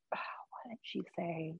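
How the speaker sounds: slow whisper to herself, trying to remember; whispered aside. Real, not acted. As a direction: murmur the question under your breath, unvoiced.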